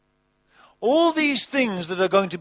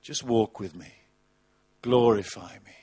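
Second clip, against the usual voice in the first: low, soft, gentle "No, no, no"; calm voice of God; 6 semitones lower, 4 dB quieter than usual. Acted. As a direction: settle them down as God would. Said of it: "God says".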